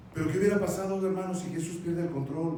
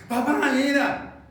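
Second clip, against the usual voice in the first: high, loud, drawn-out whine to dad; pleading child; 7 semitones higher, 6 dB louder, slower than usual. Acted. Child begging dad to let them go, pleading. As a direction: beg louder, stretching it out.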